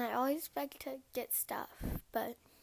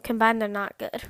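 said again louder; louder repeat; 11 dB louder than usual. Real, not acted.

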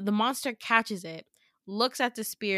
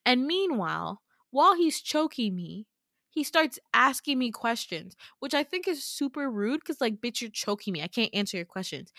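normal talking voice

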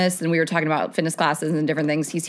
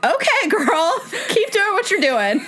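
high, giggly egging-on of a friend; laughing cheer; 12 semitones higher than usual. Retold or imitated. Narrator cheering her friend on while laughing. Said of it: "I was like".

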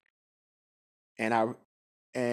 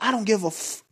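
going off; 10 semitones higher than usual. Retold or imitated. Supreme Grand Sheik cursing at the caller on the line, furious shout. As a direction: bark it like a boss losing his temper. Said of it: "he just went the fuck off".

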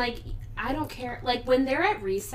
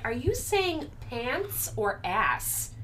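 pointed question; 2 semitones higher, 5 dB quieter, much slower than usual. Retold or imitated. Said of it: "I'm like".

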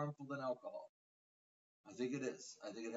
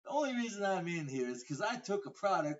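louder mock courtroom plea; cocky retold defendant; 4 semitones higher, 7 dB louder than usual. Retelling a defendant's defence to the judge, cocky mock plea. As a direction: plead the case loudly as the defendant. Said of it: "he's like".

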